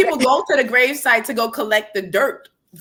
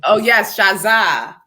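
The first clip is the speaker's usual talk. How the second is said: ordinary talk, nothing marked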